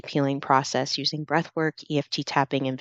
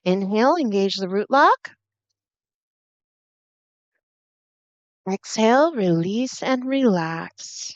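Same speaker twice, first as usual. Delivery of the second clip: breathwork coaching; 5 semitones higher, 4 dB louder than usual. Acted. Meditation guide leading root lock breaths, breathwork coaching. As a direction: lead the breath with lifted, stretched cues.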